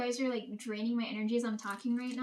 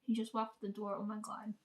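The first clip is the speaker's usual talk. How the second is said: hushed; 2 semitones lower, 6 dB quieter than usual. Real, not acted. Hiding, he just came home.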